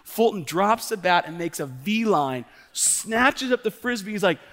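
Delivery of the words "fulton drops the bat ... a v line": usual speaking voice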